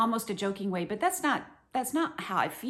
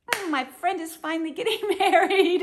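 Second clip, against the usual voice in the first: high and loud, laughing with delight; giddy laughing joy for a friend; gleeful wedding guest; 10 semitones higher, 8 dB louder than usual. Pretend. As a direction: gush over a friend's wedding. Imagined happy wedding guest.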